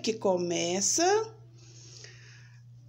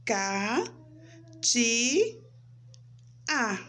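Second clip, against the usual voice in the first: "Ca, ti, a" with a pause after each syllable; much slower than usual. Shown as a demonstration. Teacher shows her name has three syllables, syllable by syllable. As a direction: count out "Ca, ti, a" as three separate pieces. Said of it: "formado por três sílabas".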